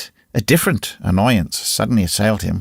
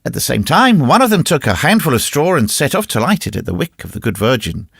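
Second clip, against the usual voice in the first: usual speaking voice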